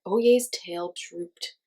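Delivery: plain reading voice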